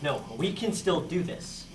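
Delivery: ordinary talk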